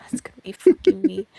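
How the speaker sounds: 1 loud outburst. Real, not acted. Streamer loudly exclaiming at the statement. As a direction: blurt it out, outraged.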